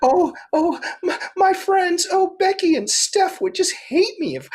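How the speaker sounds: high, fluttery mock girl voice; girl fretting about her friends, high mock voice; mimicking the anxious girl. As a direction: play her as flustered and breathless. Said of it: "She goes".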